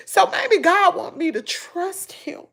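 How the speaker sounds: real tears, high and shaky through sobs; pushing words out while crying; crying while talking. Real, not acted. To her viewers, speaking about God through real tears. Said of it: "I'm on here crying".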